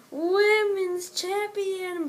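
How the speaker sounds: drawn-out announcer call; slow, high announcer build; ring announcer. Retold or imitated. Announcer-style drawn out title.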